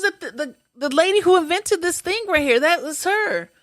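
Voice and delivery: high, emphatic imitation of the woman; insistent explaining. Patient correcting the physical therapist, high insistent imitation.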